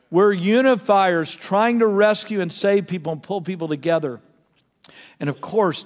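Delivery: normal talking voice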